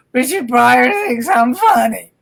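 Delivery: slow, teary wail of disbelief; choked-up, overwhelmed mock sob; mock sobbing. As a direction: replay the hysterical phone call, half crying. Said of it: "hysterical crying".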